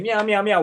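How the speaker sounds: lightly imitated cat noises; mock cat meows. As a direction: meow like a cat, mocking. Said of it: "y'all be saying".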